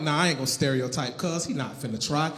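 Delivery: low, soft talk to himself; inner reassurance. Retold or imitated. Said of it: "but I'm like".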